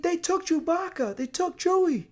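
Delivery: high-pitched mock-frantic cry; imitated panicked yelling. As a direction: raise the alarm in a hammed-up yelp. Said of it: "Finn is always yelling".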